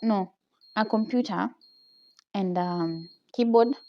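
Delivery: ordinary delivery, nothing marked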